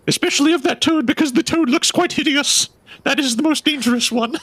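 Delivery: high, flustered townswoman voice; frightened townswoman. Acted. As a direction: squeak it out like a panicky superstitious gossip. GM voices townswoman about toad.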